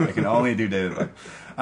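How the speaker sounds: a light, lightly voiced British footballer; David Beckham impression. Podcaster showing his only impression, a light British footballer voice.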